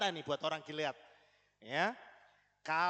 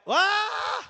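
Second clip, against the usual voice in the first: teriak "waa" panjang, tinggi dan keras; teriakan eksekusi; 11 dB louder than usual. Acted. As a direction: pekik serbuan yang diperagakan. Memerankan eksekusi orang Efraim. (teriakan lantang melengking tinggi)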